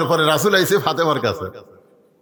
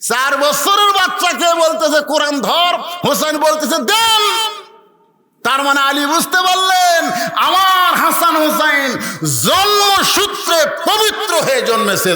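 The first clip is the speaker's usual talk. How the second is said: high, shouted proclamation with stretched words; full-force preaching; 9 semitones higher, 3 dB louder than usual. Acted. Preacher proclaiming Hasan and Hosain pure from birth, full-force shout. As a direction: cry it out to the whole crowd.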